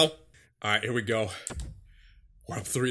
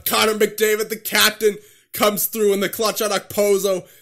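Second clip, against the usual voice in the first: raised, louder play-by-play shout; excited goal call; 6 semitones higher, 5 dB louder than usual. Real, not acted. Player calls his captain's clutch goal, excited. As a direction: punch it out high like a thrilled commentator.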